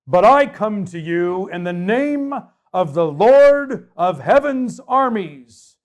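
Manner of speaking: put-on young shepherd defying the giant, loud and slow; epic battle speech. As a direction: declare it like a war cry before battle.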